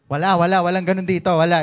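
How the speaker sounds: loud, fast emphatic denial; loud playful insistence. Real, not acted. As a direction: shout it down with a grin. Preacher emphatic denial to congregation.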